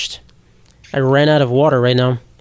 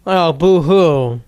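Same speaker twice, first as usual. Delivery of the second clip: sarcastic boo-hoo; much slower than usual. Pretend. Mocking sad restaurant videos.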